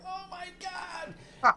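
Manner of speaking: high, slow, stunned gasp of a phrase; dread. Retold or imitated. Realizing nobody in the movie escapes, high, slow and stunned. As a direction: let it out in slow, horrified awe. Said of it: "And it was like".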